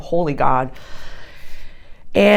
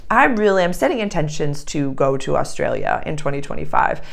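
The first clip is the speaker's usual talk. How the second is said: usual speaking voice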